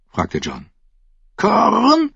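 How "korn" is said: high squawking bird voice; raven call. Acted. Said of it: "rief der Rabe".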